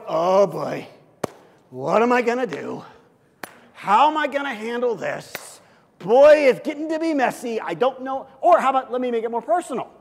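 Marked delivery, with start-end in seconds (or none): 0.1-8.3 louder, hand-wringing worried voice; fretting God. Retold or imitated. Preacher mocking a worried God on his throne, louder fretting voice. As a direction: play God as a panicked worrier.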